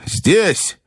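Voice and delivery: high, mild cry; calling out. A narrator's reading. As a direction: call it up to the grandmother. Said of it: "закричал я".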